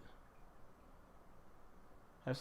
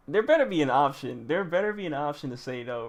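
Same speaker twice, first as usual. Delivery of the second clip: emphatic; 2 semitones higher, 8 dB louder than usual. Real, not acted. Frantic about choice.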